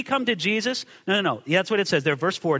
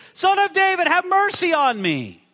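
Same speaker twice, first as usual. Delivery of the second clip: blind beggar's raised call; acted screaming out; 9 semitones higher, 3 dB louder than usual. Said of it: "starts screaming out to Jesus".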